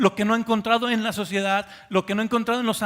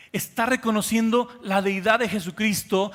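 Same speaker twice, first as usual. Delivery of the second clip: ordinary talk, nothing marked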